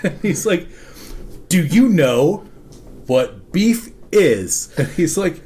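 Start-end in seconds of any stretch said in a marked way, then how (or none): 1.5-4.7 low, slow, spaced-out question; slow, deliberate repeat. Retold or imitated. Manager re-asking baffled coworker, slow deliberate retold question. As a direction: spell it out slowly, pausing mid-question.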